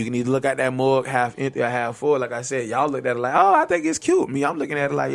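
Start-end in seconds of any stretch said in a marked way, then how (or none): 3.4-4.3 very high, squeaky mimicry; mock naive gushing. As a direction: put on a high falsetto of someone finding it cute. Mimics others' naive reaction.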